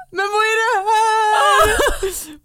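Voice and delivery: high, loud wailing mock disbelief; reenacted tearful shock. Mum reliving finding the proposal on the bodysuit, tearful mock wail. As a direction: retell it as a drawn-out overwhelmed squeal.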